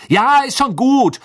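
high, drawn-out exasperated shout; loud outburst, pitch leaping up; sudden yell. A narrator's reading. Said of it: "schrie Harry plötzlich".

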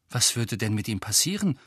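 ordinary delivery

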